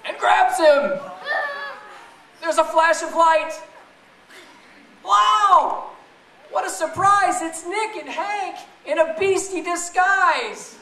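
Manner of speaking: ordinary delivery, nothing marked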